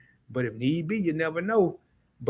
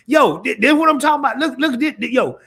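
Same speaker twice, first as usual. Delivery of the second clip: loud, high burst of real excitement; blurting it out, thrilled at what's on screen; excited; 8 semitones higher, 8 dB louder than usual. Real, not acted. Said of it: "I'm getting excited".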